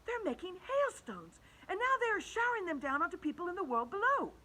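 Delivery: high, eager explaining; excited James. Acted. James explaining the hailstones to the others, high excited. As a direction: tumble out the news with wonder. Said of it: "whispered James excitedly".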